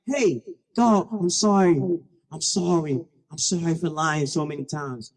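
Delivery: put-on low, contrite boy's apology; apologetic. Dead boy apologizing to God.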